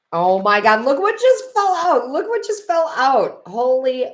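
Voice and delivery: loud, high-pitched burst of amazement; excited exclamation. Real, not acted. Card reader amazed at what just fell out, excited exclamation. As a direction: exclaim in real astonishment, pitch leaping up. Card falls out mid reading.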